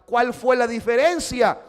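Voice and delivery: loud, high sermon voice; preacher pushing the question to the crowd; preaching at full force. Acted. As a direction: drive it home to the congregation. Preaching climax, raised voice.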